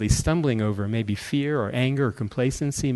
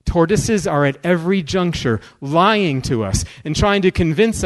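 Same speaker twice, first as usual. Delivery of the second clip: louder, higher preaching, climbing to a high peak; rising preaching; 5 semitones higher, 3 dB louder than usual. Real, not acted. Warning the brothers and sisters against tortoises, preaching louder and higher. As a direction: build the passion to a cry.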